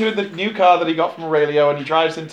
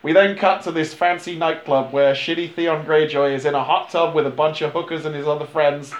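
ordinary delivery

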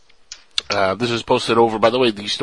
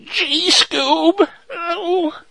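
very high cartoon voice; drawn-out, high-pitched cartoon impression; Scooby-Doo cartoon character; much slower than usual. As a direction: squeak it out like a cartoon sidekick. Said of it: "because of Scooby-Doo".

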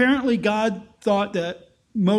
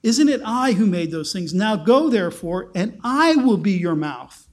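usual speaking voice

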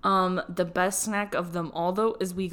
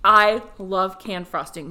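slow, loud declaration; emphatic; 10 dB louder than usual. Real, not acted. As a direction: proclaim it proudly. Declaring a guilty pleasure.

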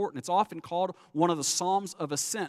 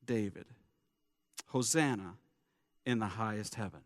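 reverent reading; 6 semitones lower than usual. Acted. Reading scripture reverently, slowing.

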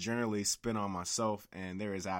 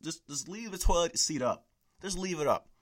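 higher, louder exasperated plea; mock the demand, fed up; 8 semitones higher, 4 dB louder than usual. Real, not acted. Ranting at females about the toilet seat, exasperated.

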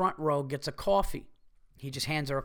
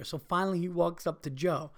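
normal talking voice

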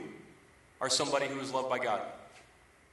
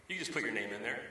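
normal talking voice

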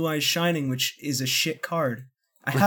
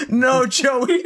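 high, loud mock wail; overly dramatic outcry; 10 semitones higher, 7 dB louder than usual. Fan mimicking chat over Joey thrown down, mock-tragic cry. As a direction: cry out in hammed-up despair. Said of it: "react overly dramatically to the scenes".

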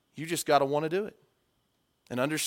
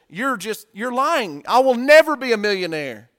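defiant retort; 6 semitones higher, 7 dB louder than usual. Retold or imitated. Kid accuses speaker of lying.

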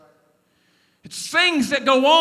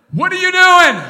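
loud, sharp late-night question to his son; fed-up dad calling into the room; mock stern call; 6 semitones higher, 10 dB louder than usual. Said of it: "I said".

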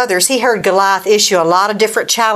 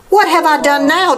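mildly acted protest, higher pitch; higher-pitched mock-innocent protest; 7 semitones higher than usual. A narrator's reading. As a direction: lightly play a scolded younger brother objecting. David protesting to scolding brother.

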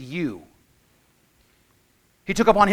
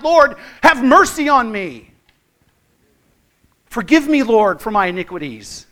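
crying out for mercy; 4 semitones higher, 8 dB louder than usual. Retold or imitated. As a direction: cry out in earnest repentance. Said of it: "fall face down at the feet of Jesus and cry out".

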